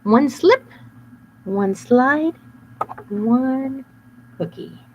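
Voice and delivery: high sly chant; scheming wolf. Acted. Wolf imagining the trap, sly. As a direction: plot it out gleefully.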